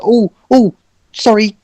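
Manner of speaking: high-pitched, flustered apology; timid, apologetic Orson. Acted. Game master as Orson apologising to Kathmandu, flustered and high. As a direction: play a sheepish, high-voiced character.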